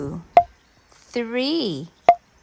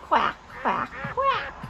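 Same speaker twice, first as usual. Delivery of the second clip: duck quack; slower than usual. Shown as a demonstration. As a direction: imitate a duck for little kids. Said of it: "A duck says".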